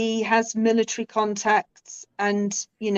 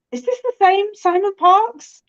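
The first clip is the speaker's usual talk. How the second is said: disbelief; 11 semitones higher, 5 dB louder than usual. Retold or imitated. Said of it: "And I'm like".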